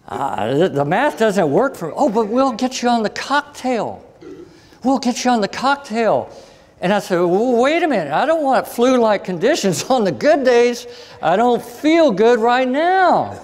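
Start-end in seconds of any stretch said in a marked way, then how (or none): 2.0-6.3 mock cheery doctor. Doctor voiced pushing the cocktail. 7.2-13.4 retold high protest. His retold protest to doctor.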